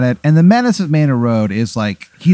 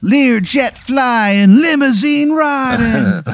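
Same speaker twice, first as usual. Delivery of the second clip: high, loud, stretched-out Ric Flair brag; the Menace's boast; 11 semitones higher, 4 dB louder, much slower than usual. Acted. Wrestler brags to the arena, high and drawn out. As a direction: draw out each word like a showboating wrestler. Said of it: "Ric Flair".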